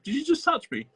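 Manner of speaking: mock-startled, pitch leaping high; high, incredulous imitation of someone unsettled; startled questioning. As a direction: quote a startled question, voice shooting up. Acting out people feeling touched.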